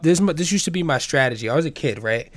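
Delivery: normal talking voice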